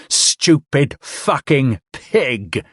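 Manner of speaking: slow, dragged-out hatred; slow raging snarl. Acted. Man slamming drunk's head, slow raging snarl. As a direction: grind each word out.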